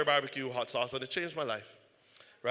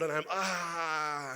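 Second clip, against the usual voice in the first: slow pained cry; much slower than usual. Retold or imitated. In agony in waiting room.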